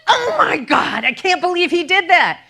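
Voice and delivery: outraged wail at the ruined sweater; really mad. Retold or imitated. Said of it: "and I was really mad".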